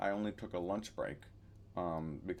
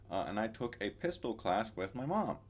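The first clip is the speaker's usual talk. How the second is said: normal talking voice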